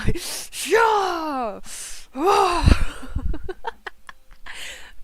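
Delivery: loud, drawn-out battle cry; attack yell imitation. Mimicking his attack moves, loud battle yell. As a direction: yell it high and long like an attack move. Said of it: "Except for his attack moves".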